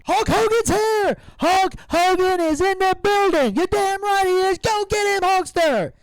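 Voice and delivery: loud, high hyped announcer call; imitating the arena promo, big and high; announcer impression. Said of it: "It was like".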